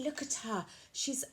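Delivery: ordinary delivery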